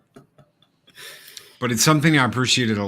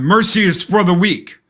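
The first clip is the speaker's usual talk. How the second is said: loud, higher snarling sensei line; John Kreese; 9 semitones higher, 7 dB louder than usual. Retold or imitated. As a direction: bark it like a merciless dojo master. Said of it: "John Kreese, man".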